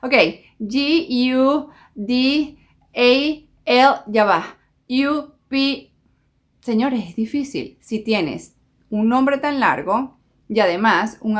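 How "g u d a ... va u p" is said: letters spelled out very slowly; slow spelling. Retold or imitated. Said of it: "Y uno dice". (deletreando despacio)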